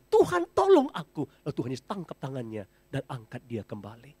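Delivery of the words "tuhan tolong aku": high, loud plea; desperate cry for help. Acted. Sinking Peter cries out to the Lord, high and loud. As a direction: cry out as if drowning. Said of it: "Lalu ia berseru".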